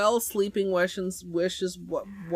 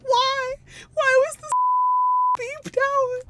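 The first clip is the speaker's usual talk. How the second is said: much higher and louder, outraged; indignant high cry; 4 dB louder than usual. Real, not acted. Streamer outraged the word got beeped, high indignant cry. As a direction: squeak the why in disbelief.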